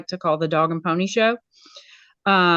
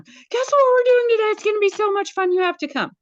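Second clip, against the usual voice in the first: very high, gushing squeal of an invitation; giddy excitement; faster than usual. Acted. Media specialist running through the front office, squealing excitedly. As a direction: squeal it breathlessly high, bursting with enthusiasm.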